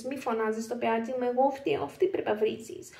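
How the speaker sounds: normal talking voice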